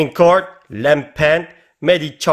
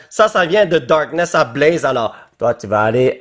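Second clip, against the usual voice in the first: usual speaking voice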